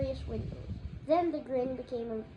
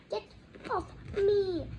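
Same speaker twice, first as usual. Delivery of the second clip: high, louder gasped cry; 8 semitones higher, 5 dB louder, slower than usual. A narrator's reading. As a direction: choke it out while being strangled. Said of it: "Harry gasped".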